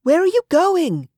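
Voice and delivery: high, loud call with a woman's voice; shouted question. Acted. Olivia calling up to Anteros on the cliff, high shout. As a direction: call it up the rock face. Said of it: "Olivia shouted from below".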